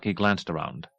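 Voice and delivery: plain reading voice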